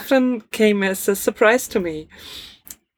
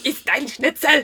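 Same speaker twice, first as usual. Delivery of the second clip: high, laughing mock German accent; bad German accent; 7 semitones higher than usual. Joining the joke about German accents, bad German accent. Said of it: "the bad German accent".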